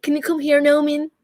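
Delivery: put-on American accent. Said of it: "that one American man who's like".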